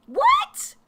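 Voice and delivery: high squeaky what; high-pitched outraged what; incredulous shriek. Real, not acted. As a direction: shriek it in disbelief. Outraged disbelief at critics.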